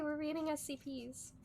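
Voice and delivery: usual speaking voice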